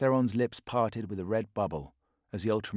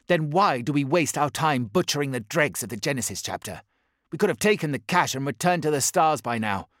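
higher and louder, pressing the point; urgent warrior; 5 semitones higher, 6 dB louder than usual. Acted. Zykon urging the Warsmith to flee before Guilliman. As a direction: argue hard for retreat.

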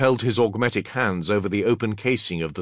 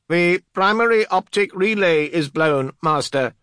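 high, loud, declaimed technician's voice; reporting adept; 11 semitones higher, 6 dB louder than usual. Acted. Adept reporting damage to the master, high loud report. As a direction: call out the fault in a raised voice.

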